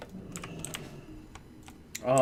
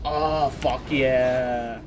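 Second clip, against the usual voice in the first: slow fuck yeah; much slower than usual. Real, not acted. Savouring a great item.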